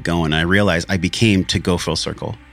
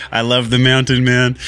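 higher and louder, teasing mimic of his partner; playful imitation of his lady; 5 semitones higher, 4 dB louder than usual. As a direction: replay her words in a gushing, teasing voice. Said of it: "She's like".

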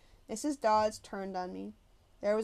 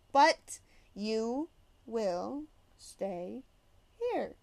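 slow menacing order; much slower than usual. Acted. Teacher threatens Grover.

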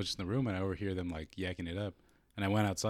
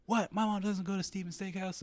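very high imitation of an affronted woman; pitched-way-up offended daughter; mock indignation; 12 semitones higher than usual. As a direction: mimic her squeaked disbelief. Imitating neighbour girl's indignant reply.

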